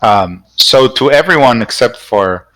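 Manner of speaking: ordinary talk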